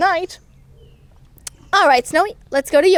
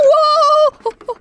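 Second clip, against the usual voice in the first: ordinary talk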